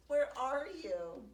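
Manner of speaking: loud plaintive call. Acted. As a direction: call out into the rain. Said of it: "his plaintive cry".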